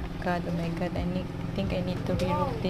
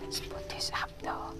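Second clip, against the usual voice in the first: whispering to self; 11 dB quieter than usual. Real, not acted. Whispering to herself.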